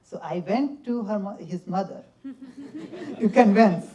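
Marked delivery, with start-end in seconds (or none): 2.2-3.9 a laugh then louder words. Real, not acted. Dancer laughing about convincing his grandmother.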